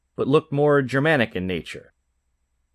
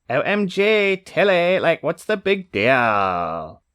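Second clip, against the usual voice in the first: put-on valley girl; 4 semitones higher, much slower than usual. Court lady in valley-girl voice.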